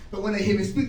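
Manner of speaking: ordinary delivery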